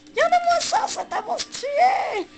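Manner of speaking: very high, loud squealing hype; excited shriek. Real, not acted. Gamer showing off a stylish move, excited shriek. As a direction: shriek it, stretching the last word.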